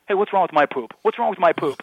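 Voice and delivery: loud, indignant caller impression; Russ Rivas. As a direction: protest it like an offended buddy. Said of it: "it's Russ on the phone going".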